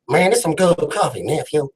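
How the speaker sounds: playful rapper impression. Host praises coffee to his guest, mock rapper voice. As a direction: imitate a laid-back West Coast rapper. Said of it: "that's my [name]".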